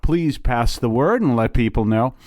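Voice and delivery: normal talking voice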